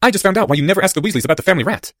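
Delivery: eager to share the scandal; excited schoolboy. A narrator's reading. Boy telling his friend what he found, high and animated.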